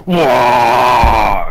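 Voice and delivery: loud, long yelled "waah"; screamed war cry. Acted. Player acts Tonga leaping off screaming, loud war cry. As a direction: bellow a drawn-out war cry. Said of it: "screaming out".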